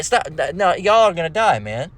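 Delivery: ordinary delivery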